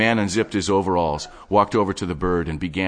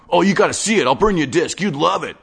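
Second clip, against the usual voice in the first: higher, fast-talking obsessive geek; breathless nerdy enthusiast showing off his stash; manic collector Wade; 11 semitones higher, 6 dB louder than usual. Acted. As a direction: rattle it off like an excited hoarder. Wade again.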